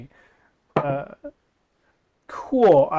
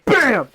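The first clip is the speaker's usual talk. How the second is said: gunshot bam; 9 semitones higher, 8 dB louder than usual. Acted. Gunshot sound effect, point blank.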